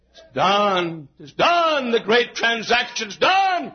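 rising, high preacher's cry; triumphant shout. Acted. Preacher echoing Jesus' last statement, triumphant shout. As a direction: proclaim it in drawn-out shouts. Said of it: "No wonder he shouted it".

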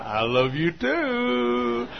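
soft, very slow cooing; mock lovestruck reply. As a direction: imitate a besotted lover, dragging the words. Mimics honeymooner's reply.

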